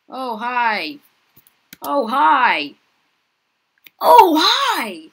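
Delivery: rising to an excited shout; building excited greeting. Real, not acted.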